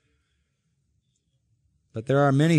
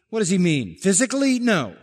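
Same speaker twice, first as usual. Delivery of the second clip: ordinary talk, nothing marked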